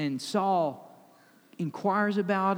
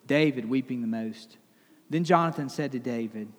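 measured, lower Bible reading; scripture reading; 4 semitones lower than usual. Acted. Pastor reads the passage aloud to the congregation, measured reading. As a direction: read the verses with weight.

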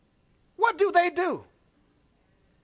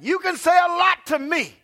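higher, louder preacher's shout; full-force preaching; 7 semitones higher, 6 dB louder than usual. Acted. Pastor rallying the parents, loud preaching. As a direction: preach it hard, protecting the babies.

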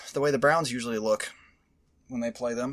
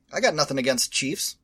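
normal talking voice

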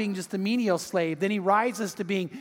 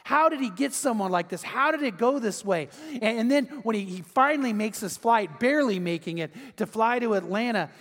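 normal talking voice

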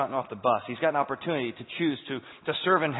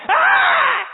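piercing high shriek; very high, loud death shriek; shrill scream; 16 dB louder than usual. Acted. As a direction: let out a dying creature's scream. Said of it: "with a shrill I had not heard before".

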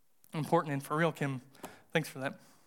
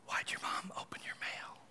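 hushed, breathless whisper; a retold whisper, barely voiced; whispered question; 14 dB quieter than usual. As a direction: whisper it so the mom can't hear. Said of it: "Like, she said".

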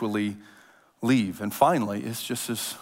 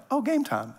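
playful game time; 9 semitones higher, 3 dB quieter than usual. Retold or imitated. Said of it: "It's like".

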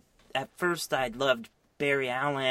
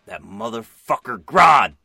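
louder, slow mock-menacing name drop; mock Jesse; 14 dB louder than usual. Playing Jesse naming the villain, loud and slow. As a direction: spit the villain's name out slowly. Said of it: "And she's like".

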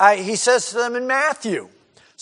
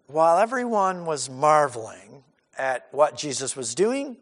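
ordinary talk

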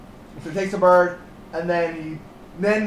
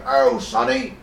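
lightly put-on British accent, higher; a hint of a jolly British bloke greeting a sad friend; 6 semitones higher than usual. British guy cheering up sad Manfred, light British accent. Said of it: "crazy British guy, played by me".

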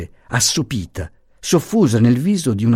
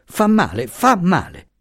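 heated reproach; 9 semitones higher than usual. A narrator's reading. Professor repeats heated protest.

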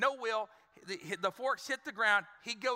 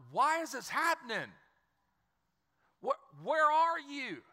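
pleading with God; 5 semitones higher than usual. Acted. Congregation's questions to God in hard moments, acted pleading. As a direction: act out crying up to God in confusion.

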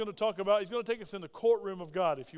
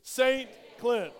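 loud, proud declaration of the name; said like you mean it; 3 semitones higher, 5 dB louder than usual. Shown as a demonstration. Congregation answering the preacher, loud and proud. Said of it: "That's much better".

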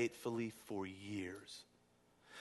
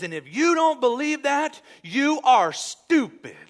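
mock shout; 11 semitones higher, 11 dB louder, slower than usual. Family member ends political rant.

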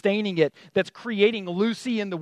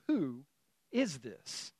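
low, soft retold question; hushed awe; 4 semitones lower, 12 dB quieter, much slower than usual. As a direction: quietly voice stunned disciples, dropping low. Disciples' awed question after the storm.

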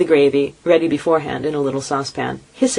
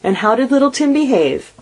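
much higher, gentle asking mother; fond mother; 9 semitones higher, 3 dB louder than usual. A narrator's reading. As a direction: ask about her youngest. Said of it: "asked Missus Cratchit".

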